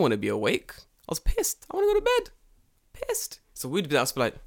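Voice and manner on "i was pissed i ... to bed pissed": high whiny grumble; whiny complaint. Retold or imitated. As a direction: whine like a cranky kid. Whiny retelling of being awake.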